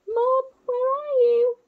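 put-on high, loud baby call; calling for mum. Baby owls call loudly for their missing mother. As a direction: call out into the dark.

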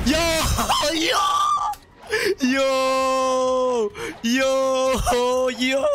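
very high, shrieking celebration; real giddy high yelling with laughter; triumphant excitement. Real, not acted. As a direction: squeal in victory, then ease down slightly. Celebrating destroying the top player.

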